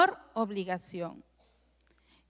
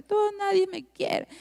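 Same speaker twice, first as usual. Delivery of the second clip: mock tearful; 8 semitones higher than usual. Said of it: "Y ella llora".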